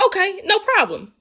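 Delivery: high, meek voice; high-pitched. Retold or imitated. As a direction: answer in a high, mild voice. Retelling reply to manager.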